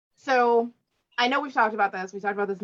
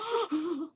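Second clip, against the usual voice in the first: exaggerated mock gasp; shocked gasp; 8 semitones higher, 6 dB quieter than usual. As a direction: gasp in imitation of a stunned listener. Imitates shocked listener hearing her speak.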